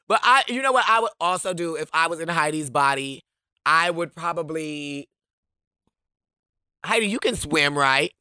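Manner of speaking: usual speaking voice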